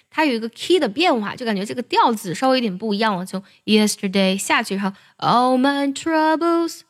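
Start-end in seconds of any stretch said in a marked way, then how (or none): 5.2-6.8 all my troubles drawn out slowly, climbing up; slow rising pitch. Shown as a demonstration. Teacher showing the key change, slow rising line. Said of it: "那慢慢往上升".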